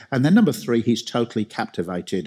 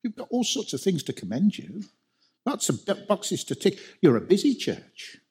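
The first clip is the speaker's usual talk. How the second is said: higher, rising preacher voice for the Lord praising the church; the Lord speaking; 8 semitones higher than usual. Retold or imitated. The Lord writing to the church at Ephesus, praising it ever higher. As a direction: build it up like a letter of praise. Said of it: "and he says".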